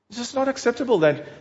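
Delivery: ordinary talk, nothing marked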